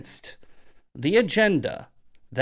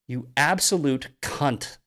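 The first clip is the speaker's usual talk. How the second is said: ordinary talk, nothing marked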